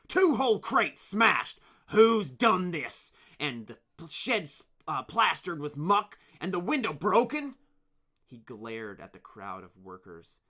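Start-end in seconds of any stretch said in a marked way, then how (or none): 0.1-7.6 angry. Acted. Angry leader questions workers.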